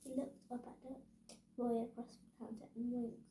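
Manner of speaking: ordinary delivery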